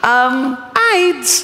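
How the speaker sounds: deadpan goat with a high squeak. Retold or imitated. Goat giving its second reason, high-pitched. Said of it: "goat answering".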